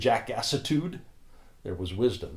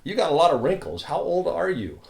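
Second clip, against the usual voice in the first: put-on blunt, booming old man, higher and louder; imitating Jim's big voice; 8 semitones higher, 7 dB louder than usual. Jim blurting across the room, big loud voice. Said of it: "Jim with his big old voice".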